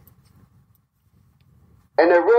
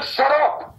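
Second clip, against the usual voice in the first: higher, hammed-up heel telling the ref off; mock heel put-down; 11 semitones higher than usual. Retelling the heel shutting up the referee, hammed up. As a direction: play the villain cutting the ref short.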